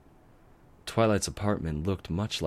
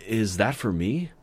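higher, louder, hopeful; eager; 5 semitones higher, 4 dB louder than usual. Acted. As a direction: ask like a kid spotting candy. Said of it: "he asked eagerly".

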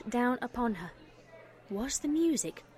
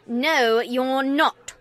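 a near-shout, much louder; almost shouting; 10 dB louder, much slower than usual. A narrator's reading. Mother refusing her daughter's claim, almost shouting. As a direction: snap back sharply, close to shouting. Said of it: "her mother almost shouted".

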